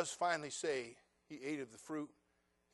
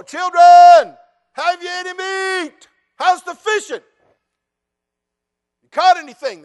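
high, loud call across the water; Jesus hailing the fishermen; calling out from shore; 28 dB louder, slower than usual. Acted. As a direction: shout out to the boat, stretched long and slow.

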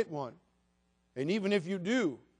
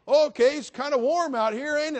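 mock small talk; 9 semitones higher, 8 dB louder than usual. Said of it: "You can't just go".

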